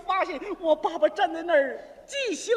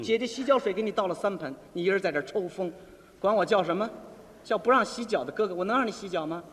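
lightly acted scolding in a lower voice; grumbling older brother; 8 semitones lower than usual. Brother complains about foot baths and poems, low scolding. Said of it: "我哥哥说".

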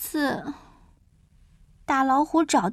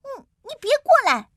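high-pitched, frightened defiance; acted scared little mouse standing up to a bully; defiant cry; 8 semitones higher, faster than usual. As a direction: cry out bravely, then falter as it rises. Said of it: "我冲他叫起来".

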